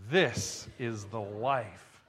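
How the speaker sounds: slow, low, relaxed this is the life; contented sigh. Shown as a demonstration. Pastor leading the congregation in a relaxed sigh. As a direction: let it out after the breath. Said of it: "let's all say it at the same time".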